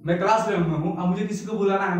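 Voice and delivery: normal talking voice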